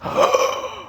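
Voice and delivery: sharp breathless high gasp; mock gasp. Doctor gasping at the parent, hammed-up shocked gasp. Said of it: "the doctor just goes".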